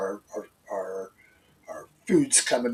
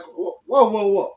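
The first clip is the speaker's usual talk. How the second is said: rising alarmed whoa, louder and higher; 9 semitones higher, 8 dB louder than usual. Real, not acted. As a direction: exclaim it in disbelief. Shocked exclamation at news.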